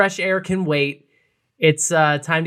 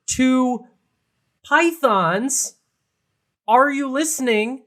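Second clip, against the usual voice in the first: dramatic reveal; 3 semitones higher, slower than usual. Real, not acted. Telling co-host what was behind the microwave, dramatic reveal. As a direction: spell out the absurd find slowly, piece by piece.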